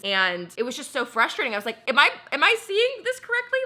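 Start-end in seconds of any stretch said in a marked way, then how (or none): 1.9-3.6 high, loud incredulous squeak; retold shocked outrage; stunned disbelief. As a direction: react like you can't believe your eyes. Disbelief at brand reposting ad.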